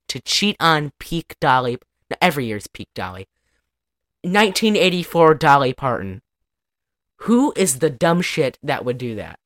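normal talking voice

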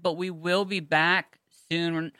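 usual speaking voice

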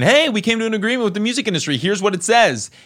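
high, fast mocking voice of the politician. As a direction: pitch it way up and rattle it off like a smug announcement. Mocking the politician's tweeted message.

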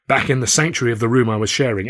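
plain reading voice

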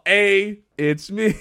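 squeaked out through laughter; cracking up mid-line; laughing. Pretend. Co-host laughing while repeating the joke line.

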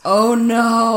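mock dismay. Real, not acted. Reacting to golf cart story.

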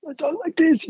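imitating a lost voice, high and soft; hoarse voice.

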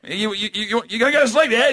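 high-pitched, stammering mock coaxing; coaxing dad. Dad pulling over to coax toddler, stammering hopefully. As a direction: prod the child hopefully, tripping over words. Dad nervously prompting son while driving.

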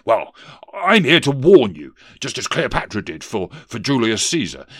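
hesitant, emphatic politician's ghost; blustering ghost. Acted. Ghost offers Scrooge a last chance, loudly emphatic. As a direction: warn him with loud, stumbling pomp.

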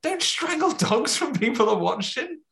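high-pitched mock outrage; livid. Lestat scolds over strangled dogs, livid. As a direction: squawk it out, comically livid. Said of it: "he's livid".

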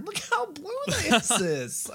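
slow, high, giggling self-admiration; laughing gush. Retold or imitated. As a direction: gush at yourself while cracking up. Retelling gazing in the mirror.

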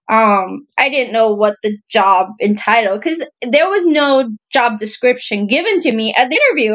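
ordinary talk, nothing marked

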